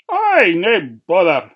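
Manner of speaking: loud cheery workman; cheery Scots workman; manny voice. A narrator's reading. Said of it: "said the manny".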